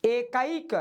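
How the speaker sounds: एकाइक with a clear 'a-i' glide before the k; correct pronunciation. Shown as a demonstration. Hindi teacher models correct speech for students, careful pronunciation. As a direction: sound out एकाइक as e-kaa-ik. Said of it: "ये शुद्ध बोलने का तरीका है".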